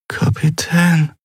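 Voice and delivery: slow, high, dreamy and happy; blissful. Acted. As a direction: say it in blissful relief. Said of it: "sagte er selig".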